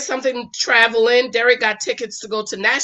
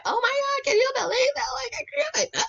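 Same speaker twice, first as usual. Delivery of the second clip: very high wailing outcry; dramatic wife; 13 semitones higher, 5 dB quieter, faster than usual. Retold or imitated. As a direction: overdo the shock, near tears. Wife's dramatic reaction acted.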